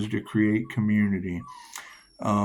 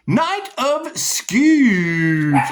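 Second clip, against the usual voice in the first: high, loud, very slow; intoned card name; 11 semitones higher, 6 dB louder, much slower than usual. Acted. Announcing the drawn tarot card, slow and grand.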